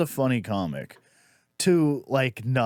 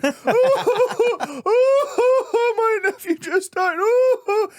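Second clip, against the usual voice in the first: high, hammed-up wailing; mock loud weeping; 4 dB louder, much slower than usual. Comic mimicking audience woman announcing a death, high mock wailing. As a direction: imitate a woman bawling in very high sobs. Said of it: "started loudly weeping and going".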